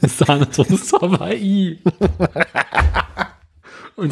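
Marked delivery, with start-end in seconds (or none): 0.0-1.8 slow, laughing through the words; can barely get it out for laughing. Real, not acted.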